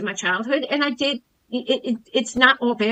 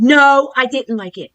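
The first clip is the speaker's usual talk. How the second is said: loud sudden no, then steady; sharp snapped no; 3 semitones higher, 8 dB louder, slower than usual. Retold or imitated. Woman rejecting coach's comment, sharp loud no. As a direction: snap back a refusal. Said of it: "I quickly snapped and I said".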